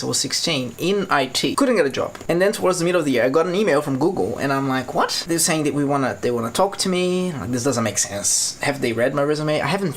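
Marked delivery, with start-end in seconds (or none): 4.9-5.2 high, disbelieving "what"; astonished. Retold or imitated. Student getting an email from Google, astonished. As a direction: jump up in pitch with disbelief.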